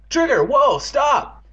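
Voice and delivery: loud, high-pitched call; shouted command. Acted. Owner to his bolting dog, shouting. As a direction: yell to halt a runaway dog.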